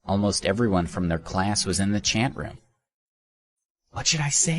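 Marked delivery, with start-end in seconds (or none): 3.9-4.6 worried thought. A narrator's reading. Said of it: "he thought".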